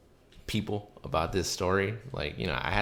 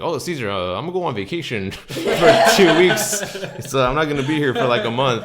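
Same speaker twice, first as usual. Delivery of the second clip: mock bubbly announcement, laughing through it; higher and louder, giggling at the news; excited laughing news; 8 semitones higher, 12 dB louder than usual. Coworker announcing her vacation to Caesar, giggly and excited. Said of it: "She's like".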